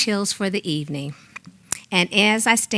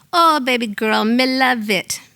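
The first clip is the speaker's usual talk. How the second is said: delighted Jamaican mother, higher voice; Mama Till's Jamaican accent; 5 semitones higher than usual. Acted. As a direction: gush in a Jamaican accent, pitched up. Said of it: "daydreaming about her kissing my forehead and saying".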